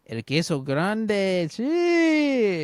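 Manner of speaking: drawn-out, loud mock Spanish flourish; showy Spanish announcement rising to a long high note; hammed-up Spanish. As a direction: ham up the Spanish name, soaring high on the last word. Reads a Spanish username theatrically.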